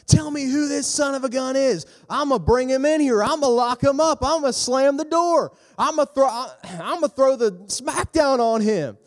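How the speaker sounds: high, loud enraged rant; furious king. Acted. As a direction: roar out threats like an outraged ruler. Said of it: "He's getting mad. He's like".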